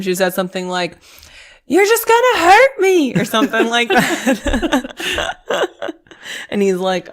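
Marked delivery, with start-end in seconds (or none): 1.7-3.1 high, loud imitation of an upset yell; mock yell. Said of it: "She, like, yelled at him". 3.1-6.5 words breaking into real laughter; laughing. Real, not acted. Laughs at own imitation.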